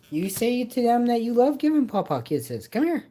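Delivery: high, loud coaxing pet voice. Real, not acted. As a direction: urge the cat sweetly. Coaxing cat to speak.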